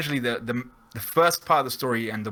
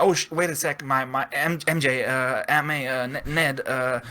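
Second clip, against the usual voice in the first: stuttering Peter Parker; 3 semitones higher than usual. Retold or imitated. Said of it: "in the style of this kind of naive Peter Parker, he's just stuttering".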